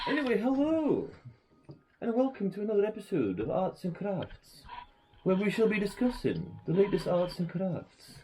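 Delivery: put-on high sing-song Welsh accent. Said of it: "I've no idea why you're doing this Welsh accent".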